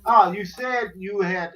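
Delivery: usual speaking voice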